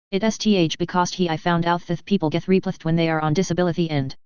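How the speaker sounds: thick lisp, "replethed" with th for the s; tongue-damaged stumble through "replethed". A narrator's reading. Scared Jaraz explaining to Gavin, lisping "replethed". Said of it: "Jaraz lisped".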